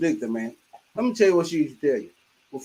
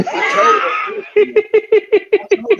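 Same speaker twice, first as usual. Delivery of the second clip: laughing; 9 dB louder, much slower than usual. Real, not acted. Laughing while telling.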